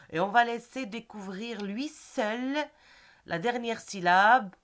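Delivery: ordinary talk, nothing marked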